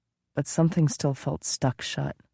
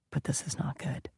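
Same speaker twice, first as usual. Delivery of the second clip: whisper; 8 dB quieter than usual. Acted. Frightened answer from the mirror.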